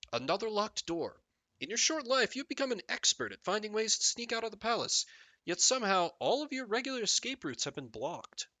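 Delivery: grand fantasy narration; game story narration. Acted. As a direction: announce the royal tale with rising and falling emphasis.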